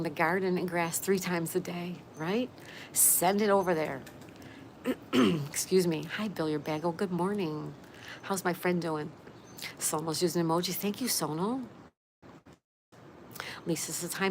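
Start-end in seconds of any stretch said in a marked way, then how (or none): none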